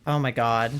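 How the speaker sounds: normal talking voice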